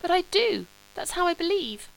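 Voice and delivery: a hint of a dreamy, earnest young man; young Sebastian.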